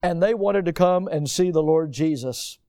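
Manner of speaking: ordinary talk, nothing marked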